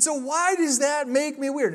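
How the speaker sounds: high, slow mock yell; re-enacted yell without full volume; retold yelling. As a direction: call it out as a stretched, high protest. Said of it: "I keep yelling at her".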